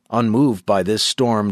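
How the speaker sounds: ordinary delivery, nothing marked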